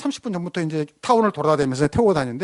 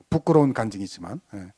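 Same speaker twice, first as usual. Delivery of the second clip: ordinary delivery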